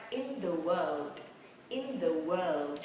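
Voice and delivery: "in the world" slow, word by word; slow teaching model ending on a long WORLD; slow phrase demo. Shown as a demonstration. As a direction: stretch each word of "in the world".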